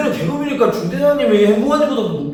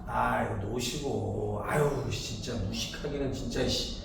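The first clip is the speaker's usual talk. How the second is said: contemptuous muttering; 10 semitones lower, 13 dB quieter, slower than usual. Acted.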